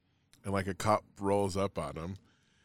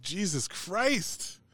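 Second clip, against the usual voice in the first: slow, high-pitched exclamation of disbelief; retold astonishment; 9 semitones higher, much slower than usual. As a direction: draw it out high, as if appalled. Retells own shocked reaction to story.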